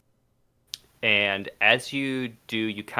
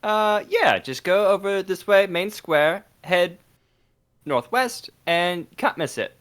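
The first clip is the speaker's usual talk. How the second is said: high Cockney urchin voice; pleading Cockney street kid; old london cockney; 8 semitones higher than usual. Acted. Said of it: "Cockney boy".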